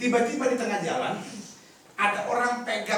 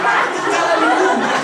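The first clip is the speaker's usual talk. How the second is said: high, loud comic exclamation; loud punchline; 9 semitones higher, 13 dB louder than usual. Acted. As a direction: burst it out as the joke lands. Punchline about panicking mother.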